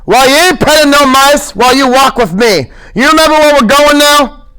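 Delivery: mild heated scold. Acted. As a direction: rebuke him sharply. George scolding.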